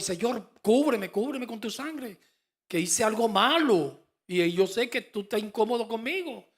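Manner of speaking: pleading prayer. Retold or imitated. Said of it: "siempre voy".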